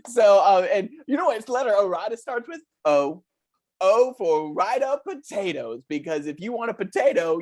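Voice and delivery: put-on chirpy children's show host talking to kids; lightly voiced kids' TV host, upbeat. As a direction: sell it like a cheesy kids' show presenter. Host pitching potatoes to kids.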